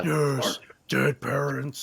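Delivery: mock brooding doom. GM mocks the tragic backstory.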